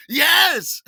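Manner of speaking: hearty yes. Retold or imitated. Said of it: "And I said".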